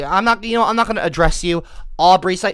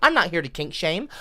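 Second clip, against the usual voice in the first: normal talking voice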